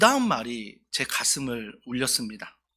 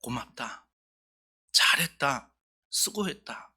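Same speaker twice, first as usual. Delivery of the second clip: God's tender voice; 2 semitones lower, 4 dB quieter, slower than usual. Retold or imitated. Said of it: "하나님은 우리에게 말씀하십니다".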